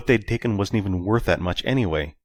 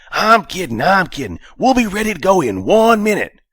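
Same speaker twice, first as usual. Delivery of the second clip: high, loud, scratchy intercom voice; scratchy speaker voice; 13 semitones higher, 8 dB louder than usual. Acted. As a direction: crackly voice over a speaker. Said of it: "with a scratchy voice".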